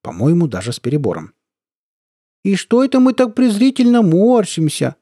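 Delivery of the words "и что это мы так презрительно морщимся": high, loud cutesy squeak; squeaky baby talk. A narrator's reading. Colonel needling men in the ranks, squeaky baby talk. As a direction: coo mockingly in a piping baby voice. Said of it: "сюсюкающим голоском вдруг пропищал".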